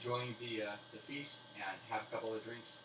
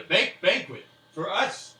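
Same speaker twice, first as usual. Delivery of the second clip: much higher, louder gasp at the word banquet; delighted disbelief; excited surprise; 9 semitones higher, 15 dB louder, slower than usual. Acted. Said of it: "he's already".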